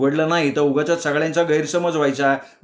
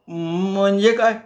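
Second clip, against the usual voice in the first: higher, slow startled cry; shrieking protest; 5 semitones higher, slower than usual. Acted. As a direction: squeal while being choked. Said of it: "मी किंचाळलो". (गळा धरल्यावर किंचाळणे)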